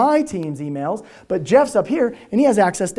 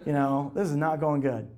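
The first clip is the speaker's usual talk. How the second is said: softer, slow and low; glum inner thought; 5 semitones lower, 10 dB quieter than usual. Retold or imitated. Thinking after the boss's scolding, soft slow groan. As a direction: mutter it to yourself, deflated. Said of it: "And I was like".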